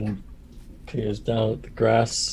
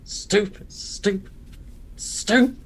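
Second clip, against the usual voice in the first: three high scornful squawks, last loudest; high furious squawks; 10 semitones higher than usual. Acted. Angry bird storming off to the inn. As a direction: screech it higher each time.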